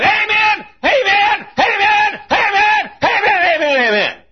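high, loud repeated amen; little man shouting amen. Retold or imitated. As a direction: fire off amens in a high little voice. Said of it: "he came up and he said".

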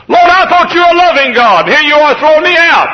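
very high, loud complaint; shouted protest. Acted. Believer complains to a loving God, shouted protest. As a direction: cry out indignantly to the Lord.

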